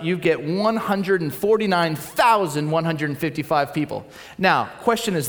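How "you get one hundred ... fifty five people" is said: usual speaking voice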